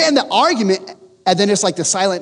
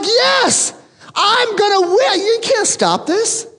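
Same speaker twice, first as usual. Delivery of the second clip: high, cocky victory crowing; gloating triumph; 13 semitones higher, slower than usual. Retold or imitated. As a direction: rub the win in her face. Gloating over the win.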